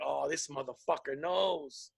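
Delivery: low, slow, quiet dread; hushed dread. Retold or imitated. A worker called into the office, quiet slow dread. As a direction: whisper it to yourself, caught out. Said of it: "I'm like".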